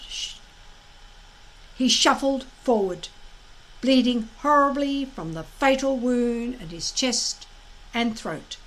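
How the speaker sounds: ordinary delivery, nothing marked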